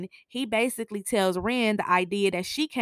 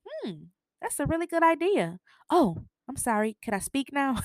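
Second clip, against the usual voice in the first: higher, mock-sweet sarcasm; sarcastic; 6 semitones higher than usual. [name] talking back at the dinner table, sarcastic. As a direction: needle the father with fake politeness. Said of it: "being sarcastic with the father".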